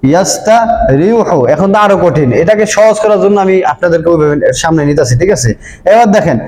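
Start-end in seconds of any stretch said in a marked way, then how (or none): 0.1-1.4 ইয়াস্তারিহু spelled out slowly; slow demo. Shown as a demonstration. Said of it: "উচ্চারণটা কি হবে?".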